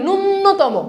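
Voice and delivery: "ন্যূনতম" with a doubled n, nun-no-to-mo; common mispronunciation. Shown as a demonstration. Teacher quoting what people often say, mispronounced with doubled n. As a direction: hold the first n long in "ন্যূনতম". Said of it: "ন্যূনতম না".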